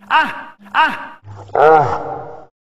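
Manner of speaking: mock little gasping noises. Narrator imitating the little noises he made, mock gasps. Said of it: "I made little noises like this".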